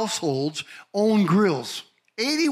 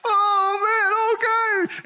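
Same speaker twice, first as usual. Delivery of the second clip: flustered groom; 11 semitones higher, 3 dB louder, much slower than usual. Retold or imitated. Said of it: "I'm blubbering, and I'm crying".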